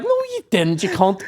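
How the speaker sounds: high mocking disbelief; incredulous retort. Pupil retorting to the teacher's pen story, high mocking disbelief. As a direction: scoff at the lying teacher. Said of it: "And you're like".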